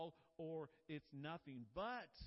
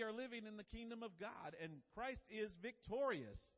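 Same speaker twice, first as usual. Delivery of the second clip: louder and higher triumphant proclamation; preaching at full force; 6 semitones higher, 5 dB louder than usual. Acted. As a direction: proclaim victory to the congregation, loud and high.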